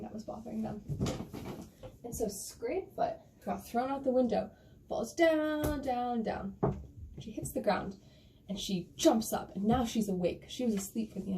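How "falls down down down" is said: descending fall. A narrator's reading. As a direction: start high, sink with each "down". Acting out the fall.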